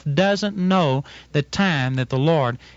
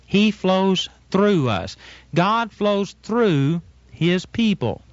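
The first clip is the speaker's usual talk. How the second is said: slow emphatic; 3 semitones higher, much slower than usual. Real, not acted. Preacher stressing key point slowly.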